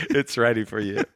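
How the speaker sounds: a hint of the doting mother through laughter; laughing mom voice. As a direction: giggle out the mother's welcome. Finishing the mother quote laughing.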